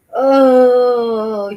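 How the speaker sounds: long, loud crying oh; anguished wail. Real, not acted. After the abuse clip, she lets out a loud crying wail. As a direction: let a real wail of grief out.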